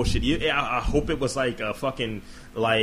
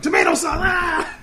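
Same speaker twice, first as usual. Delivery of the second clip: high, loud hammed-up yell; mock shout; 8 dB louder, slower than usual. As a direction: scream it like a cartoon. Mocking a movie death scene.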